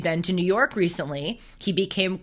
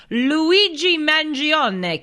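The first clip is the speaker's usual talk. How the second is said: hammed-up Italian accent, high and drawn out; bad Italian accent; 8 semitones higher, 4 dB louder, much slower than usual. Host relishing a suspect's name, bad Italian accent. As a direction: savor the name in a mock Italian lilt. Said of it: "saying it in that bad Italian".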